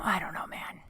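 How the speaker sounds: breathy near-whisper. Real, not acted. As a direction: let it fall to a whisper. Tired after heavy days.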